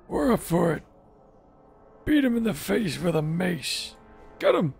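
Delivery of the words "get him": urgent battle shout. Real, not acted. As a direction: yell it at the party. Calling out in combat.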